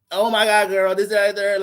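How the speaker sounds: high, loud, sassy mock gushing; sassy girl voice. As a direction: gush it like a sassy girlfriend.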